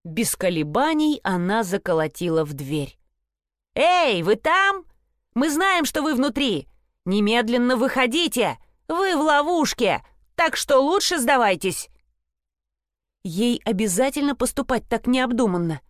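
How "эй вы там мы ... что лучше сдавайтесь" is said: shouting demand. Acted. Thunder pounds door, demands surrender.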